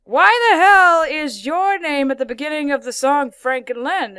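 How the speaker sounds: loud, indignant mock question; hammed-up puzzled outburst; acted cartoon character. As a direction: play a character loudly demanding an answer. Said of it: "then there's one episode".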